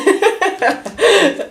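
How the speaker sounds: short high laugh at herself; amused laugh. Real, not acted. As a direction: laugh it off, amused at the Temu chair. Laughing at own bad purchase.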